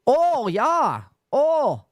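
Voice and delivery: high mock Canadian accent slipping into Australian; botched Canadian accent with drawn-out vowels. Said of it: "the way people from Canada talk".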